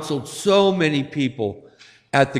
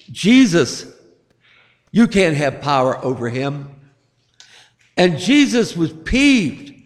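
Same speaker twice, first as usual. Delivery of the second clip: ordinary delivery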